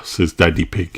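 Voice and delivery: plain reading voice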